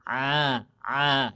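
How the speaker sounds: "A" dengan tenggorokan tengah disempitkan; ain sound. Shown as a demonstration. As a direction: tekan suara dari tenggorokan. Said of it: "ditekan tuh bunyi tuh". (ain dari tenggorokan tengah)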